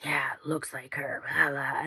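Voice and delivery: breathy retold whisper, no voice at all. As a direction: whisper it as if hiding behind the hand. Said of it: "they start whispering".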